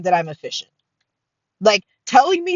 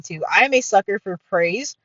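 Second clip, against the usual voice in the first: ordinary talk, nothing marked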